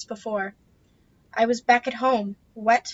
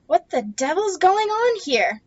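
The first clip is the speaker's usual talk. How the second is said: higher, slow, loud challenge; angry demand; 7 semitones higher, 4 dB louder, slower than usual. Acted. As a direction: demand an answer angrily. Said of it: "angry and demanding".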